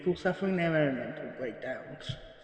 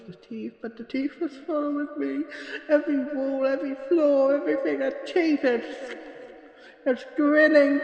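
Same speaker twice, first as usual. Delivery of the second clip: rising panic; 11 semitones higher, 4 dB louder than usual. Acted. Frightened witness recounting the grinning teeth, rising panic. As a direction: voice climbing higher and louder, near hysterical.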